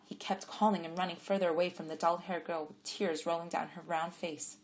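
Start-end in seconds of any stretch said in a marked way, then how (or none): none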